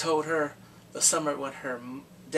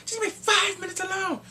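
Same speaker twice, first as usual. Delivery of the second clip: high, loud demand; angry outburst; 4 dB louder than usual. Retold or imitated. Detective demanding time with the suspect, loud shout.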